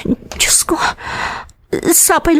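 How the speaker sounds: breathy whispered plea; hushed desperate whisper; whispered prayer. Acted. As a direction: whisper it to God in worry. Worried mother praying under breath.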